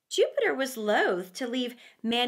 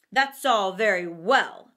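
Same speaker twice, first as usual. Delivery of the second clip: low, louder dismissive wolf; 4 semitones lower, 5 dB louder than usual. A narrator's reading. Wolf dismissing the lamb, low and gruff. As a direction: brush off the lamb's excuse.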